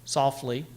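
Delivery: "softly" with the weight on SOFT and a light -ly; stress on SOFT. Shown as a demonstration. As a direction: tap out "softly" as stressed, unstressed. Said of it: "begins with a trochee, softly, stressed, unstressed".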